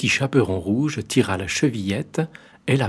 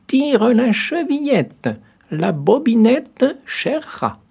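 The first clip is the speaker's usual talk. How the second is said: put-on very high, slow, loud old woman's call; granny calling out from her bed to the door; grandmother calling; 14 semitones higher, 5 dB louder, slower than usual. A narrator's reading. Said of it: "lui cria".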